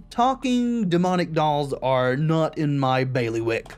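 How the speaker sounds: normal talking voice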